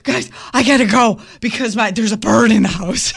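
mock overly animated panic. Co-host mimicking colleague excusing himself from meeting, overly animated. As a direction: imitate a worked-up interruption, lightly hammed. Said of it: "so overly animated".

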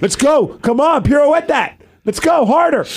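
high-pitched, urgent egging on; mock sports-fan cheering. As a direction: cheer like a rowdy hockey parent. Mimics hockey fan at ballet class.

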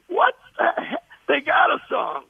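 high, shouted disbelief; shocked outrage. Retold or imitated. Songwriter discovering the film already has a song, shouting in shock. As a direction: yell it like the song's been taken.

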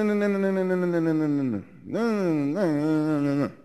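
ordinary talk, nothing marked

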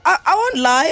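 normal talking voice

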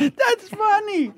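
high, slow, laughing protest. Real, not acted. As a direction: wheeze it out laughing. Insisting joke is funny.

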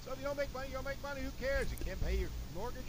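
ordinary talk, nothing marked

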